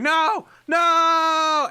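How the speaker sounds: high, loud drawn-out scream; acted scream of no. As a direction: scream it long and high in character. Voicing a character's repeated cry.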